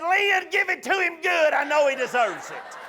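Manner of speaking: high-pitched woman calling out a car window; heckling woman. Retold or imitated. Woman yelling from her car window, high heckling voice. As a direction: cheer on the whipping from a car. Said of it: "One woman rolled down her window and said".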